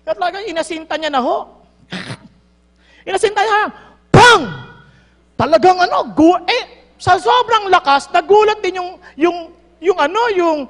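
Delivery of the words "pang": loud "pang!" gunshot noise; sudden loud spoken bang. Retold or imitated. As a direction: bang it out like a rifle shot. Gunshot sound effect.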